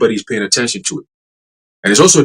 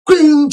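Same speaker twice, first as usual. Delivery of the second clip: high ringing "cling" sound effect; imitated glass shatter; 13 semitones higher than usual. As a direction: mimic breaking glass with the voice. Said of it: "Just like that".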